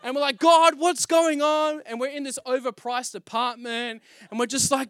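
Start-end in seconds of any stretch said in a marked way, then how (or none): 0.4-1.8 high, loud, slow plea to God; desperate cry. Retold or imitated. Crying out to God while jobless. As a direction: cry out, stretching the words.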